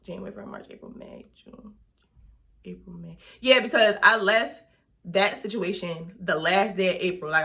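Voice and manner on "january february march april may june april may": whispered counting. Real, not acted. Working out the dates to herself, whispered counting. Counting months under her breath.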